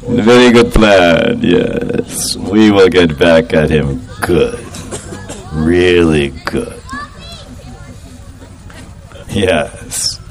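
low, slow, scheming chuckle through the words; sly gloating. Acted. Gopis plotting revenge on Krishna, slow low sly gloat.

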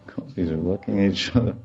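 ordinary talk

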